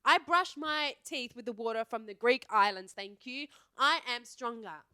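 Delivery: loud, emphatic brag; mock boasting. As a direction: boast as if invincible, punching key words. Mock boasting about her strong stomach.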